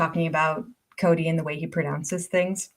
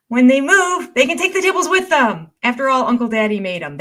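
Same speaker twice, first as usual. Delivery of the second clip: high, loud, gleeful comeback; delighted by the comment's logic; excited retort; 5 semitones higher, 4 dB louder than usual. Real, not acted. Reacting to a viewer comment, high and gleeful.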